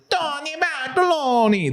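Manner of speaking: very high, hammed-up Italian accent; terrible Italian accent. Showing how people say his name, terrible Italian accent. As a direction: sing out the name like a bad fake Italian. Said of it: "like, a terrible It".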